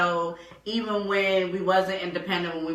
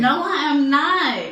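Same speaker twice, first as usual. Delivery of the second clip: indignant denial; 7 semitones higher, 6 dB louder than usual. Real, not acted. Protesting being called devil.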